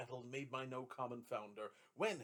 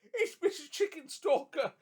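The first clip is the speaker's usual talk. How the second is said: delighted announcement; 13 semitones higher, 11 dB louder than usual. A narrator's reading. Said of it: "Trotty said".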